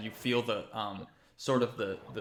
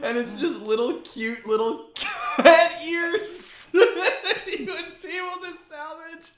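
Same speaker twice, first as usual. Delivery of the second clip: talking through helpless, high laughter; 13 semitones higher, 14 dB louder than usual. Real, not acted. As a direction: crack up while describing it, squeezing the words out. Cracking up at cat ears.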